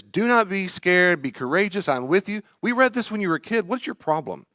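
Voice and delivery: put-on a preachy parent scolding a frightened kid. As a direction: fuss at the child with Bible verses. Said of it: "a way to fuss at your child".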